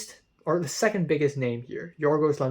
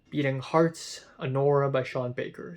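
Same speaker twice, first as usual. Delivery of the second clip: ordinary talk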